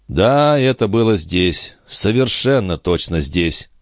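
usual speaking voice

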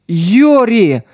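a sharp cry of the name; sudden shout of a name. A narrator's reading. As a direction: yell her name in alarm. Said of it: "заорала Алиса".

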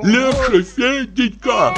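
high, fretting plea; anxious old man. Acted. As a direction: beg them to go easy. Почечуев умоляет. (тревожно, высоко)

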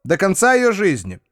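high, formal notary reading; will reading voice. Acted. A figure reads out the will.

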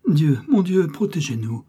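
a loud opening plea that quickly subsides; frightened stammer. A narrator's reading. Farmer's wife praying as armed men approach, frightened stammer. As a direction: call on God, then drop to a murmured plea. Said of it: "balbutie Marthe".

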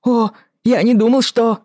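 high, loud startled yelp. Acted. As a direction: jump out of your skin. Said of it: "он испуганно подпрыгнул".

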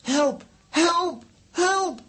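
high, loud cries for help; shout for help. Acted. Trapped character shouting for help, loud. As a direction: yell help as you are falling.

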